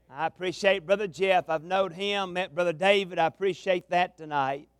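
ordinary delivery

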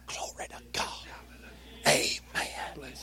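hushed whispered praise. Shown as a demonstration. Preacher keeps whispering praise to the congregation. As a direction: breathe the praise close.

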